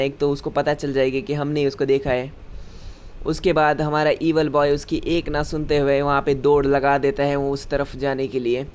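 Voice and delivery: ordinary delivery, nothing marked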